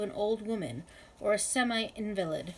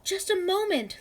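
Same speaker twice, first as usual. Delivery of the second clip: high, loud call over the noise; screaming back; 10 semitones higher, 4 dB louder than usual. A narrator's reading. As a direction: scream it back across the counter. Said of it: "Letty screamed back".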